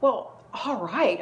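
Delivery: a loud, slow huff of giving in; exasperated all right. Retold or imitated. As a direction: drag it out, fed up. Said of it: "I get really exasperated".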